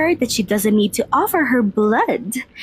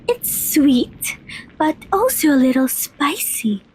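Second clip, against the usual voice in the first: ordinary delivery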